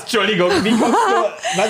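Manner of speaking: high, laughing astonished question; laughing disbelief. Real, not acted. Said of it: "Bitte was?". (lachend, ungläubig, höher)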